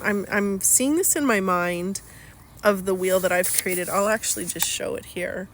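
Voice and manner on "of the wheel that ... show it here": normal talking voice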